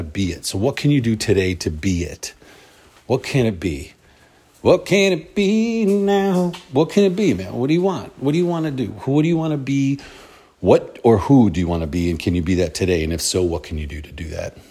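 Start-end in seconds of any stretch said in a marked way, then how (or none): 4.6-7.5 much higher and slow, stretching the words; drawn-out fired-up question. Real, not acted. Repeated question, then pushed harder. 7.5-12.1 pumped-up questions. Real, not acted. Rousing questions to listeners.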